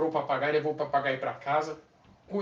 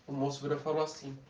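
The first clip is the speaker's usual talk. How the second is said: ordinary talk, nothing marked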